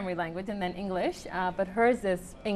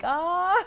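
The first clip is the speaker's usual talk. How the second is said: mock exasperated ah; 10 semitones higher, 5 dB louder than usual. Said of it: "I would be like".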